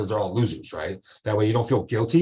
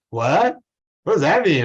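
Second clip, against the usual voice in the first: higher-pitched imitation of puzzlement; mock bafflement; 7 semitones higher, 4 dB louder than usual. Lecturer quoting the sister puzzled by "concur", baffled. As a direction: play the confused sister, pitched up.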